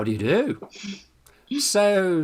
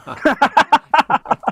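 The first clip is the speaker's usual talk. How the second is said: loud burst of laughter; 12 semitones higher, 5 dB louder than usual. Acted. Laughing at Babs's answer.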